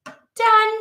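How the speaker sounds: high, loud, final done; emphatic done. Retold or imitated. Checking out of a draining reading with a loud done. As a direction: slam the door on it. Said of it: "You gotta be like".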